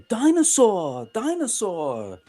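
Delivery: drawn-out imitated British cartoon voice; sing-song it like a British cartoon kid; mock-British, stretched and falling; Peppa Pig accent. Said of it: "he loves Peppa Pig".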